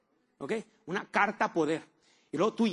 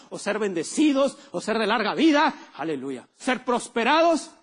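building preaching; 3 semitones higher, 7 dB louder, slower than usual. Acted. Pastor lifts the congregation with promises, rising preaching cry. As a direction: build each phrase until it rings.

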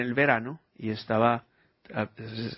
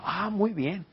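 ordinary delivery

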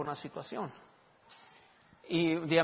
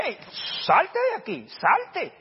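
much higher and louder, ordering her out; retold shout; 10 semitones higher, 8 dB louder, much slower than usual. Preacher telling the crying daughter to leave, shout. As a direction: send the crying daughter out now.

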